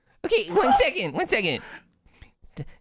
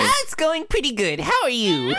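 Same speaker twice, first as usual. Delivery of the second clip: higher put-on voice; character voice; 9 semitones higher than usual. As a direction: play the disguised character, pitched up. Speaking in character voice.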